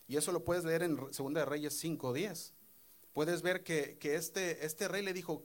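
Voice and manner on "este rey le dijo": ordinary delivery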